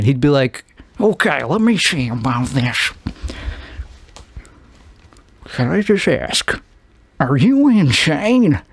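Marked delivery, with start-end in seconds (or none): 1.0-6.6 hesitant, subdued actor feeling out a line; Peter Giamatti. Acted. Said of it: "Peter Giamatti". 7.2-8.6 slow, slurred actor's line reading; Peter Giamatti. Acted. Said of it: "delivering this line".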